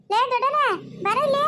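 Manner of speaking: high-pitched call to a passer-by; high, carrying village call; calling someone over. Acted. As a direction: pitch it up and beckon them over.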